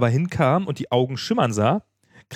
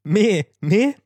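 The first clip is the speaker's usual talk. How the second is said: high mock bleat, rising; storybook goat's high call; bleating goat cry; 8 semitones higher, 3 dB louder than usual.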